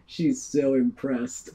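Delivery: smug thought. Acted. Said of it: "and you're thinking to yourself".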